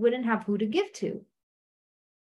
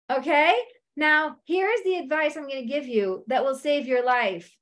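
ordinary delivery

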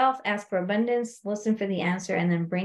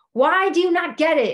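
frustrated; 6 semitones higher, 5 dB louder than usual. Retold or imitated. Husband frustrated with calm wife.

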